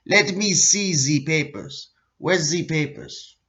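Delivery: mock German officer barking for papers; a deliberately horrible German accent; hammed-up German accent demanding papers; bad German accent. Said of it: "Excuse my horrible German accent".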